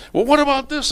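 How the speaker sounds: high-pitched acted quarrel opener; mock yelling. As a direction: start the fight as an agitated husband. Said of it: "he's yelling and screaming".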